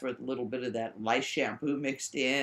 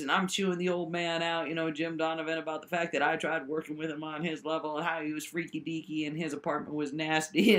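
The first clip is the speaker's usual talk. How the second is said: ordinary talk, nothing marked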